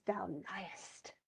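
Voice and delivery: soft, sly whisper; hushed invisible interjection; whispered taunt. Acted. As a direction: whisper it as if unseen. Invisible Ariel slips in a taunt.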